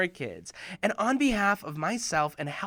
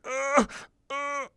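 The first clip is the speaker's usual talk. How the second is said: higher effortful grunts; straining grunts; 8 semitones higher than usual. Retold or imitated. Boy struggling to open a stuck box, grunting. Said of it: "I was like".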